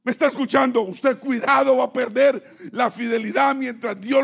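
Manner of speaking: loud, pounding preacher's exhortation; full-force preaching. Acted. Preacher warning the congregation to stay faithful, loud and forceful. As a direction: hammer the warning home to the church.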